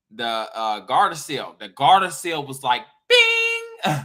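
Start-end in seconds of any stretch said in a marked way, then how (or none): 3.1-4.0 very high sung bing; ping it way up. Retold or imitated. Imitating the Gardasil, a very high bing. Mimicking vaccine sound.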